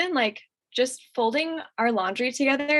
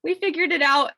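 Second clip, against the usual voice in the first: high, light imitation of a little sister; breezy cover-up; 9 semitones higher than usual. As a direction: brush it off in a higher, breezy voice. Voicing sister covering up to mom.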